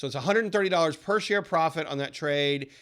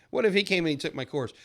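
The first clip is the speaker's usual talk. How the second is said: normal talking voice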